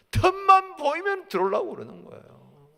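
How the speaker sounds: high, loud warning cry; forceful preaching. Real, not acted. Preacher explains the prowling devil, urgent loud preaching. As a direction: ring out the warning to the congregation.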